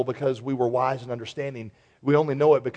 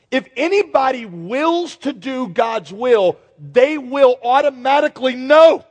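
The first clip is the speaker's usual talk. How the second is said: preaching; 6 semitones higher, 6 dB louder than usual. Acted. Preaching at full force.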